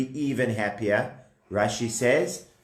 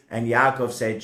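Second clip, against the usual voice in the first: usual speaking voice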